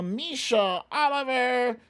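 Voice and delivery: usual speaking voice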